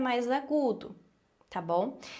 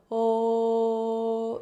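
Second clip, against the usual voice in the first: "O" sung through round lips; O shape; 4 dB louder than usual. Shown as a demonstration. Teacher shows the O mouth shape, rounded tone. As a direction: sing a round "O". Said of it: "com o formato do O".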